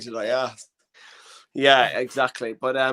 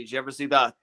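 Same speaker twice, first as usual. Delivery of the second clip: ordinary talk, nothing marked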